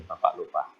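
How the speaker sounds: ordinary delivery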